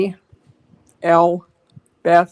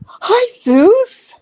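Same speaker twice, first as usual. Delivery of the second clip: high, bright pet greeting; 11 semitones higher, much slower than usual. Real, not acted. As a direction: coo at the dog up high. Greeting dog in baby talk.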